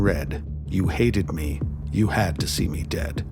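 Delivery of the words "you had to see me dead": ordinary delivery, nothing marked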